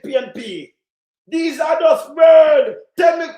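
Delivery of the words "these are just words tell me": slow, loud, furious; shouting every word slowly at the politicians; angry shout. Real, not acted. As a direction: spit each word out in disgust.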